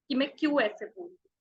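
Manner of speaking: usual speaking voice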